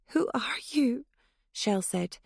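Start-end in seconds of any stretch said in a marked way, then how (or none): 0.1-1.0 scared breathy whisper; frightened whisper. Acted. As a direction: barely dare to ask. Terrified driver at knifepoint.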